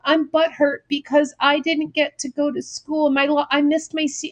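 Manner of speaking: whiny snowflake. Retold or imitated. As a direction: imitate a moping student complaining. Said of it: "whiny little snowflakes who are like".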